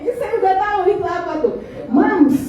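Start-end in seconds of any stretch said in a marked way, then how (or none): 1.9-2.4 "Mams" dengan s panjang, nada lebih tinggi dan keras; drawn-out Mams. Retold or imitated. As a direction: tirukan anak memanggil "Mams" dengan desis s yang dipanjangkan. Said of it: "S-nya banyak". (meniru anak kedua memanggil ibunya, "Mams" dengan s panjang)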